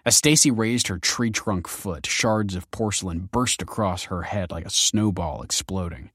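ordinary delivery

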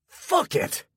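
sudden high yell; furious shout of a ruined gambler; yelled curse. Acted. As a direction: explode at the bad news. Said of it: "he yelled".

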